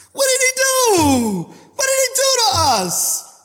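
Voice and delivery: high, slow, drawn-out cry; long wailing preacher's question; impassioned cry. Real, not acted. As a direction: cry it out high, stretching the last word. Preacher cries out an emotional question.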